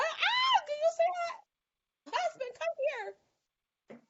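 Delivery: very high, drawn-out shriek calling her husband; slow, high-pitched thrilled cry; overexcited squeal. Retold or imitated. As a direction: squeal in delight like you can't believe your eyes. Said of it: "this how I be acting at home".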